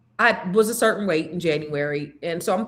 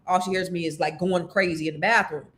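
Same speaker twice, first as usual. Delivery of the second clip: usual speaking voice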